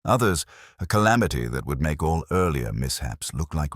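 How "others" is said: ordinary delivery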